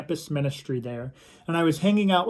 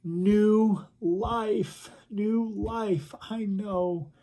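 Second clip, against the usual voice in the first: higher, slow, lightly acted sobbing; imitated tearful voice; 5 semitones higher, much slower than usual. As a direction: answer as if through tears. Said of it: "through her tears and through her sobbing".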